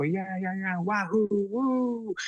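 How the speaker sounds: imitating a thrilled child cheering; excited cheer. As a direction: whoop like a kid jumping on the couch. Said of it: "they go".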